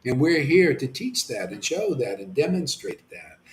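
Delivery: ordinary talk, nothing marked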